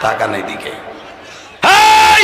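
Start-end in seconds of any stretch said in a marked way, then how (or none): 1.7-2.2 sudden high, loud "hey!"; sharp shout. Real, not acted. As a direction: yell to grab the crowd's attention. Suddenly calls audience to order. (হঠাৎ উঁচু গলায় জোরে হাঁক)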